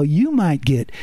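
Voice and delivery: ordinary talk, nothing marked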